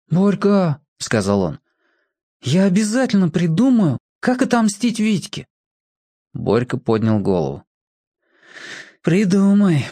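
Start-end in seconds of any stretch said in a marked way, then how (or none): 0.1-5.5 put-on a touch of a boy's voice, promising; young boy vowing revenge, gently; lightly voiced boy Petka. Petka promises Borka revenge, lightly boyish. 9.0-9.9 joyless. Acted. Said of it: "сказал он невесело".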